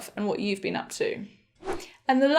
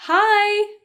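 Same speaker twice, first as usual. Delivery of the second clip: high, loud, held-out hello; friendly greeting; 9 semitones higher, 6 dB louder than usual. Shown as a demonstration. Teacher modelling an informal greeting for friends, high and loud.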